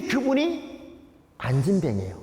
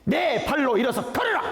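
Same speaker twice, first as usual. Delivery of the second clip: high ringing shout of command; loud command; 9 semitones higher than usual. Retold or imitated. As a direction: call it out with full authority. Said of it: "큰 소리로 이렇게 말합니다". (큰 소리로 외치는 명령)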